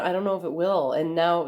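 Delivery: normal talking voice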